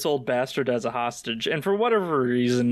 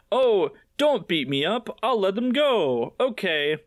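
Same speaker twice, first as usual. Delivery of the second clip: mock cowering; 5 semitones higher than usual.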